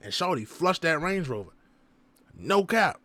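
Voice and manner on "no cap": ordinary talk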